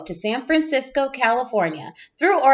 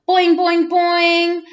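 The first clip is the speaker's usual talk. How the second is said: bouncy boing; 5 semitones higher, much slower than usual. Acted. Bouncing seats.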